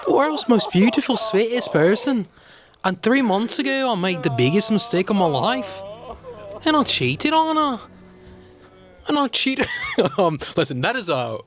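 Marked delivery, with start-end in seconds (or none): 0.0-9.7 hammed-up Norwegian accent. Host impersonating the bronze medalist's confession, mock Norwegian accent. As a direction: overdo a weepy Norwegian accent. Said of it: "I'm gonna try to do this with an accent".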